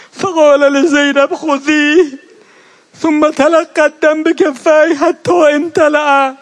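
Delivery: اوج روضه، بلند و زیر، با بغض و گریه. Acted. (مداح در اوج روضه برای عزاداران، بلند و گریان)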